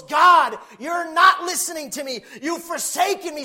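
high, very loud anguished plea; crying out. Acted. Preacher as forsaken Jesus addressing his Father, crying out loudly. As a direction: cry out to God at full force. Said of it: "He's crying out to God".

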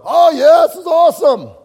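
loud, high, over-the-top fake delight; sarcastic cheer at a grim street; mock enthusiasm. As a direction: shout it like you love it but don't. Fake enthusiasm about rough neighborhood.

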